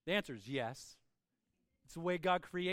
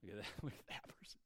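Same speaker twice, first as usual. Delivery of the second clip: inner scoff; 11 semitones lower, 17 dB quieter, faster than usual. Retold or imitated. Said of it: "in your head you're like".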